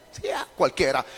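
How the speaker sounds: normal talking voice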